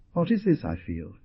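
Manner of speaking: plain reading voice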